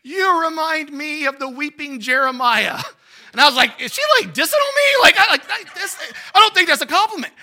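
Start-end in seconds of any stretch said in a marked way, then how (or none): 0.0-2.8 put-on slow, higher old-lady voice; drawn-out old woman's pronouncement; little old lady. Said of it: "this little old lady". 3.8-7.3 high, loud, incredulous outburst; comically affronted disbelief; mock indignation. Acted offended reaction to compliment.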